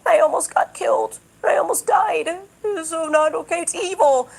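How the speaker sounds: high, put-on whining, pitched well up; mock his drama in a high whine; whiny mock quote. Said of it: "now he's like".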